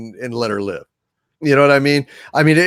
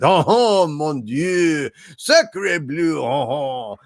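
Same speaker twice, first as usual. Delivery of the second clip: cartoonish, badly faked French accent; exaggerated stage-French exclamations; Frenchy Doomcock; much slower than usual. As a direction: ham up a terrible mock French accent. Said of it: "as bad as Frenchy Doomcock's French accent".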